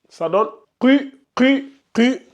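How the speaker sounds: "qu" repeated from the back of the throat; throaty qaf. Shown as a demonstration. Teacher drilling the class on a throaty qaf. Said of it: "Mettez votre doigt ici".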